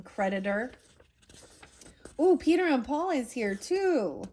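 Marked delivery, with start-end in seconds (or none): 2.2-4.2 delighted. Real, not acted. Reader's aside, delighted discovery.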